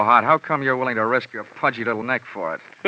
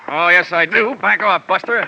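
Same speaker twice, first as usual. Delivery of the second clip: loud, higher, fighting back; defiant shout; 6 semitones higher, 6 dB louder than usual. Acted. Detective shouting at the henchman during the scuffle. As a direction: bark it while struggling. Fight breaks out over the gun.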